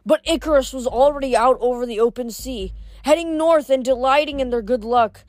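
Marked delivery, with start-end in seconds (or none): none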